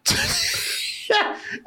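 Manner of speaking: airy high-pitched laugh; high breathy laugh. Real, not acted. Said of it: "It's like".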